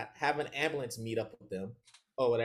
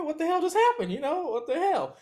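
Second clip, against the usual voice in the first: baffled disbelief; 14 semitones higher, 4 dB louder than usual. Retold or imitated. Dog left, confused in hiding.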